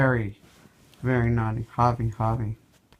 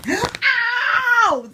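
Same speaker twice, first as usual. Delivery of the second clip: pained yelp; 8 dB louder than usual. Real, not acted. Scratched by cat, real pain.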